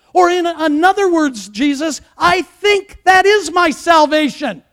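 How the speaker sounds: fervent address to Jesus, building to a high shout; impassioned preaching. Acted. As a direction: preach it as a direct declaration to Jesus. Declares his faith to Jesus.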